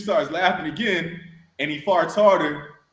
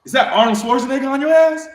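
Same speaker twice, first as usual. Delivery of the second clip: sergeant's disbelieving outburst, rising high and loud; incredulous drill sergeant yelling across the latrine; shocked sergeant; 9 semitones higher, 6 dB louder than usual. Retold or imitated. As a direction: bark it at the recruit like you can't believe your eyes. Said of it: "And Sergeant Santiago goes".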